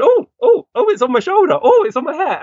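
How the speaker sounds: startled sitters feeling a parrot land on them; excited sitters. Retold or imitated. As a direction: squeal as a bird lands on you. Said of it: "everyone's going".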